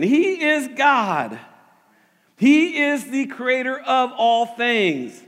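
slow, fervent proclamation; preaching at full force. Acted. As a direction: thunder it from the pulpit. Preacher climax after "Yes!.

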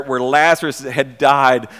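usual speaking voice

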